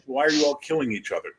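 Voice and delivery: ordinary talk, nothing marked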